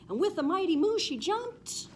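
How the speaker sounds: high, loud burst of excitement; mighty leap. A narrator's reading. Narrator voices the cow's mighty leap, high and loud. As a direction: launch the line like a big jump.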